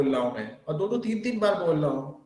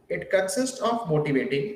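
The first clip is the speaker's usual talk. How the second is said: slow, spaced dictation with repeated phrases. Shown as a demonstration. Teacher dictating notes to students, slow and spaced. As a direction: spell it out slowly for note-takers. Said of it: "बहुत धीमे धीमे बोल रहा हूँ".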